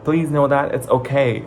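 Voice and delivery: normal talking voice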